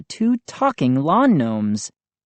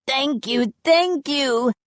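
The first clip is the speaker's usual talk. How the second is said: much higher, joyful thanks; crying happily; 11 semitones higher, slower than usual. A narrator's reading. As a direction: gush with gratitude. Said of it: "Chip cried happily".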